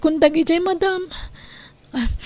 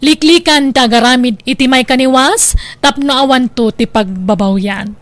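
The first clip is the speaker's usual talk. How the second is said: louder, measured narrator closing line; drama narrator moral; 2 semitones lower, 5 dB louder than usual. Acted. Narrator sums up the drama's lesson for listeners, firm and formal. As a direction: deliver the story's lesson like a radio narrator.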